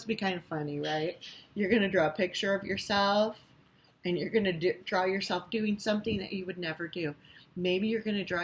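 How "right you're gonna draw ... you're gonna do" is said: ordinary talk, nothing marked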